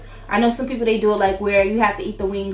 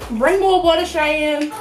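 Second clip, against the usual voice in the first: calling out; 6 semitones higher, 3 dB louder, slower than usual. Real, not acted. Calling for water, mouth burning.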